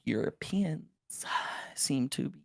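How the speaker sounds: unvoiced whispered trailing words; whispering. Real, not acted. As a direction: drop to a whisper.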